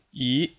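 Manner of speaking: E rising steadily; second tone. Shown as a demonstration. Teacher shows learners the second tone, steadily rising E. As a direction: slide E upward with no dip at all. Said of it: "second tone".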